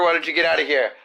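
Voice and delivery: usual speaking voice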